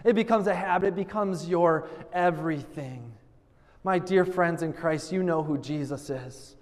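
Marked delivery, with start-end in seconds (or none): 3.8-6.5 solemn benediction. Acted. Pastor blessing the congregation at the close, solemn and low. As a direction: send them out gently in Jesus' name.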